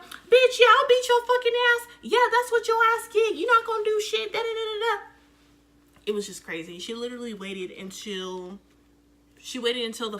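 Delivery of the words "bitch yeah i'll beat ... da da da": much higher, louder jeering yell; imitating the girl taunting once security holds them back; mock taunting yell. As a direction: jeer from behind the security guards.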